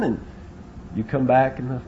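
ordinary delivery

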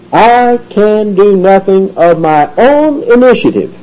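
high, slow declaration leaning on I; emphasis on I. Shown as a demonstration. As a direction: hold I long and high, then keep the line slow and insistent. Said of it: "the emphasis upon himself".

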